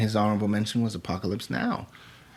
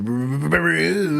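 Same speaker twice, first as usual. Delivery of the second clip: drawn-out announcer; 5 semitones higher, 4 dB louder, slower than usual. Acted. Announcing show segment.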